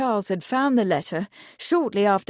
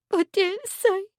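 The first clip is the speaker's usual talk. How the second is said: high, small frightened whimper; a scared girl shrinking back; lightly voiced frightened whimper; 11 semitones higher than usual. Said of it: "she whimpered".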